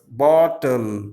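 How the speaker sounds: "Bottle" in two beats, bot-tle; teacher splitting "Bottle" into two syllables. Shown as a demonstration. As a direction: draw out both halves of "Bottle". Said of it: "Two syllabic words".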